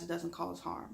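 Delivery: ordinary delivery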